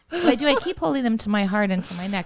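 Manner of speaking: ordinary talk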